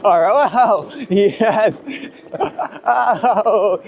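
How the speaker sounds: ordinary talk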